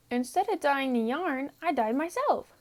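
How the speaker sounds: put-on high, quick, cheerful young sheep voice; Wilbur the lamb. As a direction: chirp it like a proud little lamb.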